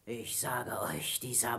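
whisper. Acted. Pirate whispers accusation.

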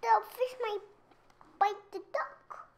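excited. Real, not acted. Child excited.

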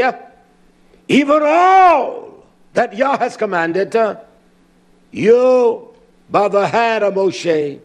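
high, loud, slow, every word hammered; scripture preached at full force. Acted. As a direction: proclaim the verse like an alarm to the people.

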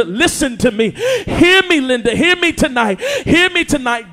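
full-force Sunday preaching. Acted. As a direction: push the words out over the crowd.

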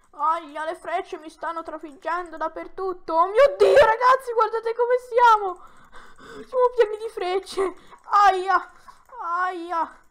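high, loud startled outburst at the arrows; excited shout. Real, not acted. Shouting to the guys about being full of arrows, excited and loud. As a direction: yell to the guys, stunned.

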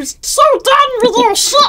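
squeaky high helium rant; chipmunk-pitched annoyed outburst. Pretend. Player riffing on the helium joke, squeaky and high.

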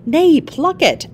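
high, slow, coaxing insistence; urging. A narrator's reading. Husband urging his wife to pluck the flower, coaxing. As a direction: lift it high and draw it out.

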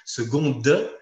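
"seconde" with a released D, "segon-de"; final D sounded. Shown as a demonstration. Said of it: "si on avait un enchaînement consonantique".

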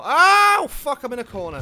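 sudden high yelp of alarm; real panicked outburst; startled yelp. Real, not acted. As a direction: cry out as you get cornered.

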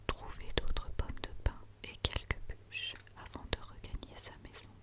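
ordinary delivery

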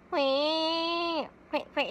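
slow stretched comic hoi call; Minami impression. A light impression for viewers of comedian Minami. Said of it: "南くんならできるかも".

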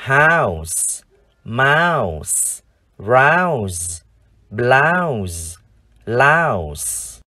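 "House, mouse, rouse, blouse, louse" each drawn out with pauses between; word-list drill. Shown as a demonstration. Teacher leads viewers re-reading five words, each long and separate. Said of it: "chúng ta cùng đọc lại năm từ này".

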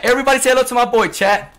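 loud, fast hype greeting; hype shout. Real, not acted. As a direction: hype up the stream.

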